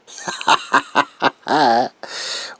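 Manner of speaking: loud manic cackle; high, loud villain cackle; evil cackle. Acted. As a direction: cackle with glee at the patient. Villain laugh.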